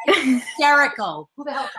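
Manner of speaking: ordinary delivery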